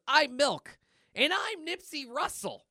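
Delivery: high, chirpy introductions; hammed-up high character voice; cartoon characters. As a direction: announce yourselves in silly cartoon voices. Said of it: "And you hear".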